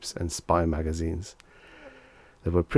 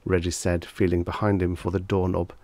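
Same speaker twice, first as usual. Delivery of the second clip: ordinary delivery, nothing marked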